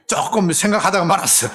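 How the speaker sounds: laughing. Real, not acted.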